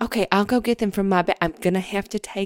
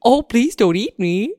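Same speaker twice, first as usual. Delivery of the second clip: worm puppet voice; 3 semitones higher, 7 dB louder than usual. Acted. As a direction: beg not to be eaten as a puppet. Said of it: "it's a hand puppet".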